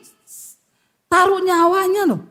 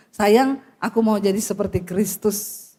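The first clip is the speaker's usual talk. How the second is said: tender promise; 8 semitones lower than usual. Pretend. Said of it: "Coba bilang sama istrimu".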